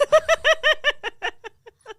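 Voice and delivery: loud, high burst of laughter; big laugh. Real, not acted. Host cracking up after the penis joke, real laughter. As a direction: crack up at your own punchline. Laughing at her own punchline.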